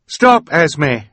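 high, loud warning cry; urgent cry. A narrator's reading. Violet stepping out masked to halt Esme, urgent cry. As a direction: call out sharply to halt her. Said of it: "she cried".

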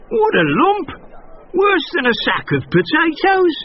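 very high, strained donkey voice; grumbling donkey. A narrator's reading. Donkey complaining about the heavy miller. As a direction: groan under the weight. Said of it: "grumbled the donkey".

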